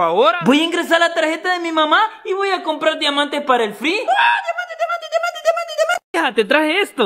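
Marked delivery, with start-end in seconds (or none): 3.9-6.0 high gleeful chanting; excited squeal. Acted. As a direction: squeal it like a greedy kid. Gleeful chant buying game diamonds.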